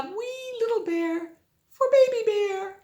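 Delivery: high, squeaky tiny voice. Acted. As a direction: raise it way up for wee. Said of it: "tiny voice for wee bowl".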